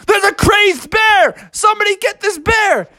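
cartoonish high-pitched alarm; alarmed cry. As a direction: call for help in a high, frantic voice. Panicked zookeeper calls for help.

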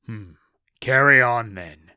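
loud, heavy orc boss voice; orc boss pronouncement. Acted.

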